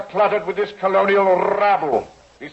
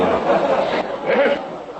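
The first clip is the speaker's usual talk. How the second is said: scornful laugh; 3 semitones higher than usual. Acted. Judge laughs at outburst.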